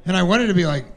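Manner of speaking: ordinary talk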